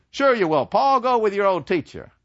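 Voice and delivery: high, loud, urging father; encouraging father. Acted. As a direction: push him to go, brightly. Father urges son.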